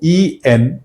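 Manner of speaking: slow letter-by-letter chunk; spelled chunk slowly. Shown as a demonstration. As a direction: dictate "ई एन" as one slow chunk. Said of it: "एक word ये हो गया". (धीरे-धीरे टुकड़ा बोलें)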